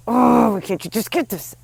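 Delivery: sharp, impatient snap at yourself; a loud frustrated "Oh", then scold; mock self-scolding, exasperated; harsh tone. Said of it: "with a harsh tone to myself".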